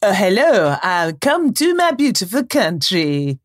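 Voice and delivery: Spanish accent. Shown as a demonstration. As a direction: attempt at a low-pitched Spanish accent. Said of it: "here's [name] doing her first accent".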